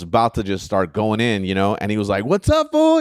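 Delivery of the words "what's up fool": squeaky high, cheerful hey; high excited greeting. Retold or imitated. Comic recalls the other comic greeting him, high and cheerful. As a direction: greet him high and bright like an old friend.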